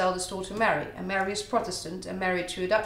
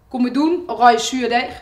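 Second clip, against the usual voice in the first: Harry's jeer in Haags, higher and louder; Hague dialect; 3 semitones higher, 9 dB louder than usual. Acted. Harry from The Hague taunting the king in Haags. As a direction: call out the mocking line in broad Hague dialect. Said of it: "I'm doing this in Haags".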